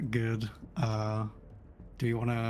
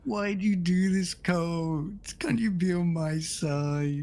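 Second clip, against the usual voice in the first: mock betrayed plea; 6 semitones higher, 3 dB louder, slower than usual.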